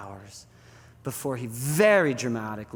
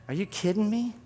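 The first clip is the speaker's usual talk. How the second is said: higher, rising disbelief; incredulous; 7 semitones higher than usual. Acted. Jesus answers Peter's resignation, incredulous. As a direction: question it as if unbelievable.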